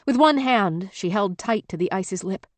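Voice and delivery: plain reading voice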